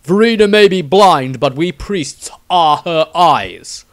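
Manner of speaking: loud, then slow righteous declaration; stern priest. Acted. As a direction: proclaim it like a holy sentence. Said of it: "nowhere to run or hide".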